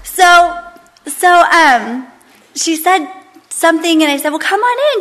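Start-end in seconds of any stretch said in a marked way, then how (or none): 4.3-5.0 high, singsong welcome; welcoming call. Retold or imitated. Hostess to the lady at her door, high welcoming lilt. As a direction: sing it out high to welcome a guest.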